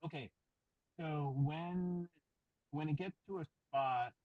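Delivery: muffled by hand over mouth. Real, not acted. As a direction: speak through a covered mic. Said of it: "'cause it sounds like you're muffled".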